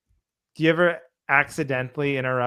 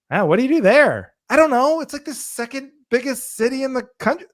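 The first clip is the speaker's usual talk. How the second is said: hammed-up exchange, way higher and louder; mock exasperated; 11 semitones higher, 5 dB louder than usual. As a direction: play both sides, incredulous. Acted asker and retort.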